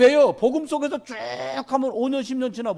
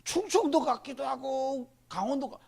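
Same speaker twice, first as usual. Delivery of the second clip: higher, drawn-out musing; puzzled guessing; 6 semitones higher, 3 dB quieter than usual. Retold or imitated. Listener unsure which province the accent is from, slow and puzzled. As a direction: waver aloud between two guesses, slowly. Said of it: "애매한 거요".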